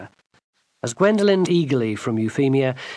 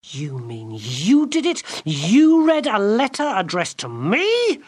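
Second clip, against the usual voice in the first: slow, rising to high outrage; girl realizing the enchanter read her letter; outraged disbelief; 8 semitones higher, 4 dB louder, slower than usual. Acted. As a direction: disbelief turning to fury. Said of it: "Gwendolen stared at him".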